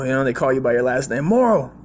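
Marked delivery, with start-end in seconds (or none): 1.2-1.7 raised, loud barked order; old Marine boss ordering hot links; gruff boss order. Retold or imitated. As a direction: boss barking an errand. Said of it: "That's how he talked".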